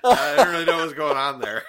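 words breaking up with laughter; laughing while talking. Real, not acted. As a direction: talk through helpless laughter. Laughing fit after trailer.

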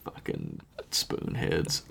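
low slow hushed whisper. Acted. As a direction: breathe it out under your breath. Muttered insult about Cardassians.

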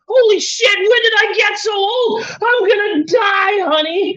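high, loud mock panic; panicked shouting. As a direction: wail it in exaggerated terror. Retells panic about being old.

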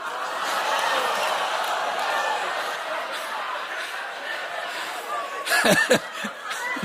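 long high airless laugh; a long helpless breathy laugh fading down; long wheezing laugh. Real, not acted. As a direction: laugh until the breath runs out. Laughing at his own story.

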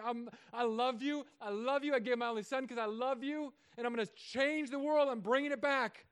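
God pleading his love to you; God speaking. Acted. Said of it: "He's saying".

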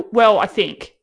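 ordinary delivery

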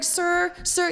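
high-pitched attempt to interject; pleading caller. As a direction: plead to get a word in. Retold caller trying to interrupt.

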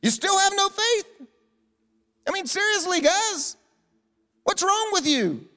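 high, loud, incredulous scolding; just woken and yelling at them in disbelief; slow, high-pitched outburst of exasperation; exasperated Jesus. Acted. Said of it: "apologize for my tone of voice".

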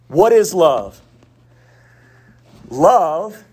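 normal talking voice

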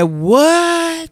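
high, drawn-out disbelief; shocked retold reaction. Niece hearing granny said love, shocked.

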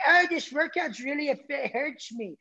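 high, slow whiny mock complaint; mocking whiner. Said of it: "When these people are like".

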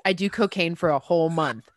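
ordinary talk, nothing marked

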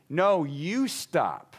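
put-on slow, drawn-out kid backtalk; smarting off. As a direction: sass the dad back slowly. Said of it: "that's smarting off".

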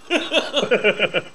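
high-pitched chuckle; laugh. Real, not acted. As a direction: laugh at your own joke. Laughs after joking request to viewers.

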